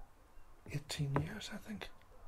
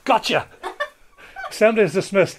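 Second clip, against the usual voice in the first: loud, high, gleeful shout; spring the April fool on the whole hall; triumphant prank reveal; 8 semitones higher, 15 dB louder than usual. Retold or imitated. Principal springs April fool on school.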